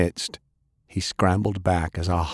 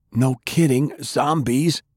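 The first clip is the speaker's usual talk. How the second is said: put-on young man's panicked retort, pitched up; alarmed young man; 7 semitones higher than usual. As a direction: snap back in alarm. Francis panics at zombies.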